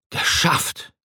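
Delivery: whispered, out-of-breath Geschafft; whispering Geschafft with no voice left; exhausted gasp. A narrator's reading. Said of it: "keuchte Odysseus erschöpft".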